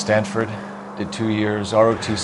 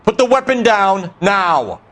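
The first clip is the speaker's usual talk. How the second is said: commanding shout; 10 semitones higher, 4 dB louder, slower than usual. Acted. Cop ordering armed man.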